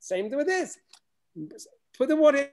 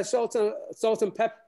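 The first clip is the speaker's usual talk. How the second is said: normal talking voice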